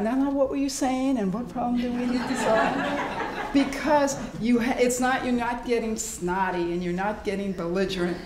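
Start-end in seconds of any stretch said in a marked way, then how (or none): 0.0-3.5 calm and composed. Retold or imitated. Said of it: "go trotting back out".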